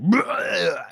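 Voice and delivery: drawn-out mock belch, rising high; imitated burp. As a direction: act out a loud retching burp. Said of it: "Burr burps a lie".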